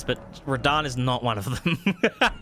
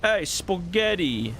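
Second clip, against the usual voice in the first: slow, drawn-out "Hey, spaghetti" like a cartoon mobster; mock Italian mobster greeting; mobster accent; 6 semitones higher, much slower than usual. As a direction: ham up the Italian wiseguy. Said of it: "add a strong Italian accent".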